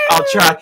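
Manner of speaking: high shrieking eagle cry; eagle screech. Pretend. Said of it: "asked me to do an impression of an eagle".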